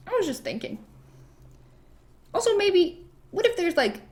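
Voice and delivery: high, coy hinting; playfully acted casual hint; feigned innocence. Queen feigning innocence, hinting at plan.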